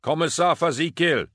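high, loud searching shout; calling out. A narrator's reading. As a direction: project the name down a dark tunnel. Calling into the dark for companions.

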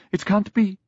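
high, stricken protest; shocked disbelief. A narrator's reading. Young woman refusing news of a death, shocked. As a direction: pitch leaps up in disbelief.

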